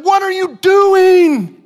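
high, loud alarmed shout at son; dad shouting at son who jumped before bike, loud and high; angry shout. Retold or imitated. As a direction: yell at him, startled and cross. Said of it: "I ran up to him and I said".

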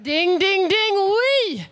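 loud mock bell for a right answer; excited game-show buzzer call; winner's bell. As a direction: chime out the dings, bursting into oui. Host imitates correct-answer bell.

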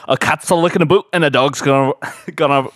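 fast Scottish patter that breaks down; Scottish accent. Pretend. Host asked to repeat his phrase, Scottish accent. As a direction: race through the saying in Scottish. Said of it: "Say it again".

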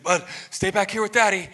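puffing mid-run, trying to sound fine; out of breath. Retold or imitated. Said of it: "You're just kinda breathing heavy".

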